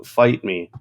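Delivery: ordinary talk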